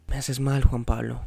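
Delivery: ordinary delivery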